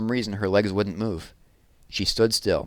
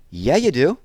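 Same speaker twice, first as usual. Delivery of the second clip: put-on higher, louder little-girl insistence; insisting; 7 semitones higher, 5 dB louder than usual. Jessie insists to her brother he needs change, higher and louder. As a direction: insist she's right. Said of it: "insisted Jessie".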